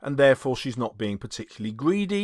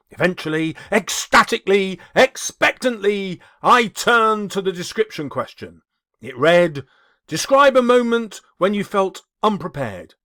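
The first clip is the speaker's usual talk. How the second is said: louder, over-the-top storytelling; hammed-up dramatic reading; 2 semitones higher, 5 dB louder than usual. A narrator's reading. Teacher reading his exaggerated exam story, hammed up. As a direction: ham up every adverb. Overboard adverb story read.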